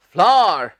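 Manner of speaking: फ्लावर said "flaar", no v; flower as flaar; correct pronunciation. Shown as a demonstration. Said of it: "क्या कहना है".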